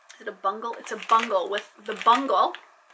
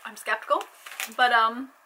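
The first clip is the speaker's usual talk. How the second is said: ordinary talk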